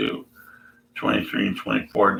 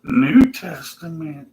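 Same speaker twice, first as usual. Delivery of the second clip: higher, slow preachy voice; 7 semitones higher, slower than usual. Pretend. Imagined believer dismisses the Old Testament, smug and slow. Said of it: "he's gonna say".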